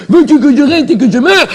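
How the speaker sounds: ordinary talk, nothing marked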